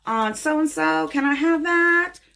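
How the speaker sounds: high, slow excited client asking; eager client. Retold or imitated. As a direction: gush like a client who spotted the nails. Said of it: "when people come back and say".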